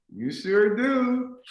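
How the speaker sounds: slow, high agreement; placating. Retold or imitated. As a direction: stretch out a humoring reply to mom. Son placates his mother.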